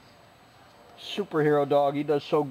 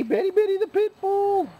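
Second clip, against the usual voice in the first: much higher squeaky voice for the dog. Real, not acted. Owner cooing at pit bull Betty, squeaky baby talk. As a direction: croon her name up high.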